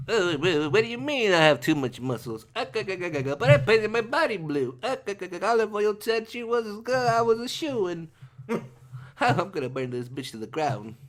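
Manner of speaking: Popeye voice. Retold or imitated. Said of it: "Popeye being rejected ... Ready?".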